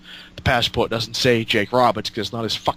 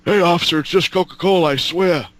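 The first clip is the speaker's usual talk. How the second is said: higher, louder innocent plea to the cop; imitating Jake pleading; 5 semitones higher, 5 dB louder than usual. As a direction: play Jake swearing it's only Coca-Cola.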